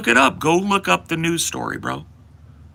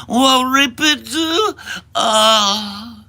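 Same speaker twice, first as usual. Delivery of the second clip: put-on slow, higher, halting old-man mumble; mock Biden stammer; 8 semitones higher, 4 dB louder, much slower than usual. Streamer mocking Biden, halting slow old-man stammer. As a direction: stumble over the words like a doddering old man.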